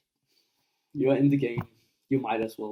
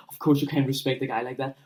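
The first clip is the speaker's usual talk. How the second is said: ordinary talk, nothing marked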